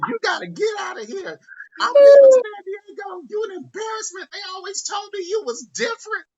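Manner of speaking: high crying shout, mom losing it; screaming mom. Retold or imitated. Mother screaming at her son, crying and shouting. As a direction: wail it out, high and loud. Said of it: "loudest piercing scream ... screaming and crying".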